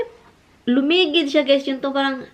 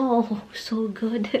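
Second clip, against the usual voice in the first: mock contented sigh; much slower than usual. Sarapan siya.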